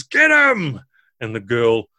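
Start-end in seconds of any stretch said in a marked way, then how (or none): 0.0-0.8 loud high barked order; ogre shout. Acted. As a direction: bellow the order at the ogres. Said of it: "Royal says".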